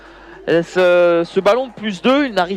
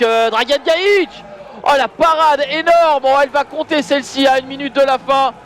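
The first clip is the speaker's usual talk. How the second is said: excited play-by-play; 6 semitones higher than usual. Real, not acted. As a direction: call the final save with full excitement. Final save and final whistle.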